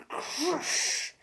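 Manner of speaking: breathy crushing sound; whispered crunch effect. Acted. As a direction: hiss the crush.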